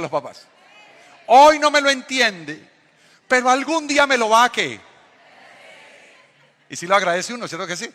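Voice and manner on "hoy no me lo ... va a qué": hammed-up dad lecture, louder and higher; imitating dad's lecture. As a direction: shout it like a father warning his kid. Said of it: "El discurso de mi papá era".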